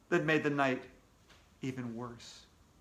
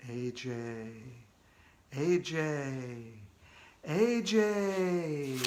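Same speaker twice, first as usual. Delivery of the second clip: ghostly call; 2 semitones lower, 3 dB quieter, much slower than usual. Acted. Ghost calls the boy.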